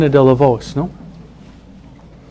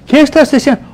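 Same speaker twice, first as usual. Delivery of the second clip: high, sharp wife's challenge; suspicious wife; 10 semitones higher, faster than usual. Retold or imitated. Wife catching husband staring, high and sharp. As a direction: catch him staring and demand answers.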